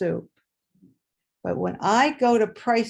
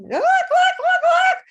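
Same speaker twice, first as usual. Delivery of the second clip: very high, loud, slow squawking with a laugh; frantic squawks; 11 dB louder, slower than usual. Retold or imitated. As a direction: squawk like a doomed chicken. Chicken squawking before slaughter.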